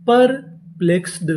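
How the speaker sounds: Perplexed said slowly, each syllable clear; slow model reading; slow full word. Shown as a demonstration. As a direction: present the finished word slowly. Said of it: "और यहाँ पे D है".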